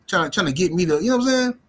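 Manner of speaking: ordinary delivery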